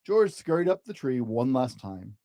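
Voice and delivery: plain reading voice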